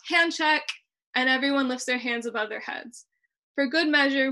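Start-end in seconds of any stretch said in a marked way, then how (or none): 0.1-0.6 higher, a bit louder mock call-out; playful yell. Family member calls out the joke, playful yell. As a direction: shout it like a family game. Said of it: "one of us will yell".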